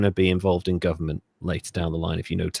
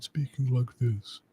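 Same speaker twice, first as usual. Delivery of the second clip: slow, lowered politician's voice; lowered Thatcher voice; 7 dB quieter, slower than usual. Retold or imitated. Telling co-host how she changed her speech, imitated lowered voice. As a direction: put on a deliberately lowered, slower voice. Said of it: "she lowered her voice".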